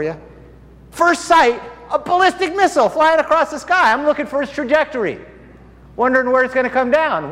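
high, loud, fervent testimony; full-force preaching. Acted. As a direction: preach it out to the whole hall.

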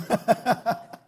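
high breathy laugh. Real, not acted. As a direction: burst into amused chuckles. Laughing at his own remark.

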